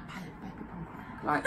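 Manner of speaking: hushed whisper to warn a friend; discreet whisper. Retold or imitated. What a friend should whisper about the bum crack, hushed. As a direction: whisper it so nobody hears. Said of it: "Instead of Courtney being like".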